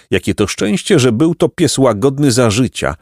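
ordinary delivery

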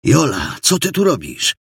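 astonished greeting, pitch jumping up; surprised question. A narrator's reading. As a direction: ask in amazement. Assistant astonished to find her there. (zdumione pytanie)

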